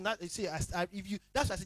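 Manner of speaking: usual speaking voice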